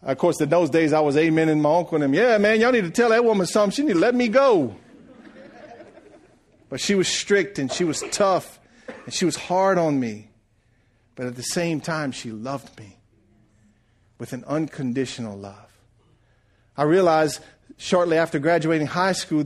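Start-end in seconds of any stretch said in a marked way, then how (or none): 2.1-4.8 put-on cheeky teen. Teen siding with his uncles. 12.1-15.8 tender. Real, not acted. Tender memory of his mother.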